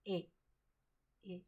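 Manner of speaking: ए as a brief open "a" as in cat; short A demo. Shown as a demonstration. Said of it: "मुंह को खोलना है". (ए छोटा, मुंह खोलकर)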